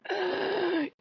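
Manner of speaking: high staged gasp; a hammed-up wounded gasp; mock gasp. Owner fakes hurt at Loki with a high gasp. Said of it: "I just looked at him and went".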